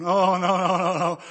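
loud, acted group moan; dismayed moan. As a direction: moan loudly in dismay for the class. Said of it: "there's a moan".

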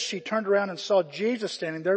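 normal talking voice

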